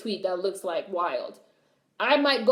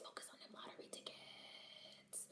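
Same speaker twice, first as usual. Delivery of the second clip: breathy, slow whisper; 32 dB quieter than usual. Real, not acted. Vlogger urging chat to focus, in a whisper. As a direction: whisper it like a secret chant.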